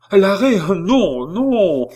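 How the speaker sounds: high, loud slowed cry; anguished cry. Acted. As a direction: cry it out in despair. Jeanne cries out.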